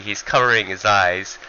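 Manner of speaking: usual speaking voice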